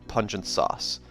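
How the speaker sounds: ordinary delivery, nothing marked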